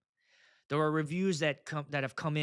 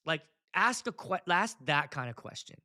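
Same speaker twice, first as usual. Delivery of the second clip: ordinary delivery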